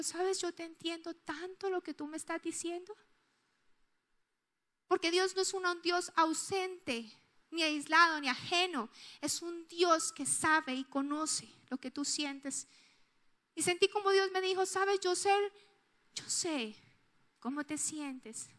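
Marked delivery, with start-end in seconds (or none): 0.0-2.9 much softer, tender reassurance; gentle voice of God. Acted. God comforting the grieving mother, soft and tender. Voicing God's gentle reply. 14.7-18.5 much softer, tender reassurance; gentle voice of God. Acted. God comforting the grieving mother, soft and tender. Voicing God's gentle reply.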